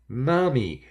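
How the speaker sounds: high, loud excited yell; a little boy shouting with joy; child's yell. A narrator's reading. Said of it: "he yelled".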